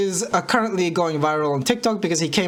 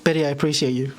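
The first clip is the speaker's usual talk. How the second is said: normal talking voice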